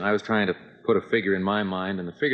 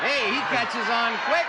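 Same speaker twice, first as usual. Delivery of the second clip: excited teasing; 13 semitones higher than usual. Real, not acted. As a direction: cheer him on teasingly. Teasing cheer at contestant.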